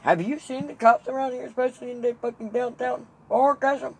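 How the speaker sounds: high, loud disbelieving rant; incredulous outburst. Retold or imitated. Dad hearing the catch-a-cop plan, incredulous shouting. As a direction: shout it as if the idea is absurd.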